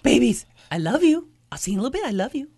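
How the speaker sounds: soft, tender pet-parent goodbye; talking to dogs. Acted. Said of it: "I say it to my dogs too".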